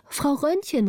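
high, eager call across the room. Acted. Gritta at the door calling to old Frau Rönnchen, high and eager. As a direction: call her name up high. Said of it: "rief Gritta".

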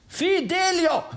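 high-pitched call of a name. Acted. Bertha calls to the Freiherr downstairs, calling out. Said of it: "rief Bertha".

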